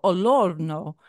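olorno said lightly with an Italian accent, ol-OR-no; a hint of Italian on olorno. Shown as a demonstration. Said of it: "we say it in an Italian accent now".

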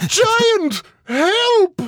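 high urgent cry for help; calling for help. Acted. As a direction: yell to wake the giant. Harp calling the giant.

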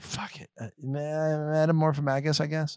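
soft, half-hearted giving up; tired late-night shrug. Acted. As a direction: mumble it like a worn-out writer settling. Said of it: "one in the morning and you were just like".

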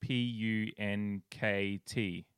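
slow, letter by letter; spelling out. Shown as a demonstration. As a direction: spell each letter out slowly. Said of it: "It's spelled".

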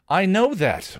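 sharp, high-pitched retort; snapping. A narrator's reading. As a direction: cut back in irritation. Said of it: "Willow snapped".

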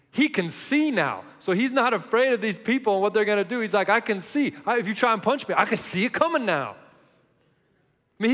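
loud, high, fired-up preaching; full-force preaching. Acted. Pastor to congregation, about the healed blind man, fired-up preaching. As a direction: shout the joy of the healed man.

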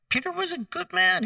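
preacher defending Peter with rising fervour; earnest insistence. Real, not acted. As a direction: lift it high in earnest.

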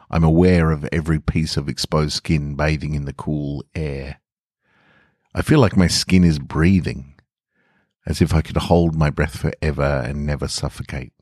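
usual speaking voice

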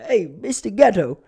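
high, loud nervous hello; nervous greeting. Retold or imitated. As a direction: call out to him, too eager. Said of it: "I'd go up".

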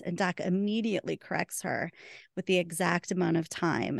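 ordinary talk

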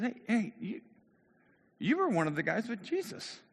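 voiced bystander pointing the finger; accusing servant girl. Acts servant girl accusing Peter.

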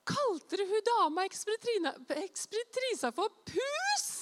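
high-pitched, scandalized question; shocked disbelief. Retold or imitated. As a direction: imitate someone appalled at what was heard. Retold shocked question from onlooker.

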